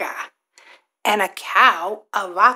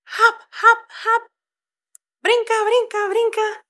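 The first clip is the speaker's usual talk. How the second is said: bouncy hop; 12 semitones higher than usual. Shown as a demonstration. Acting out bunny hopping.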